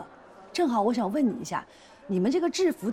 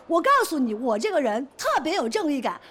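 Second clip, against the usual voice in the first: loud, raised-pitch protest; indignant shouting; 5 semitones higher, 4 dB louder than usual. Acted. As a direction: argue your case at full volume, affronted. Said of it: "好，好，好，好，别喊，". (激动地高声争辩)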